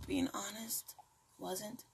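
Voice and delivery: unvoiced whisper, just for the listener; whispered aside. Real, not acted. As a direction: drop to a confiding whisper. Muttered aside about failed appeal.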